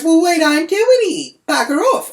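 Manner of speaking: loud, high mock-shrewish retort; heated refusal, voice right up; angry dismissal. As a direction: imitate a cross shop woman yelling. Shop woman's side of quarrel.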